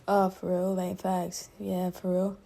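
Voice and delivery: soft, laid-back dude voice; imitating his chill talk. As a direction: lightly voice a chill guy. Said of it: "he's so like".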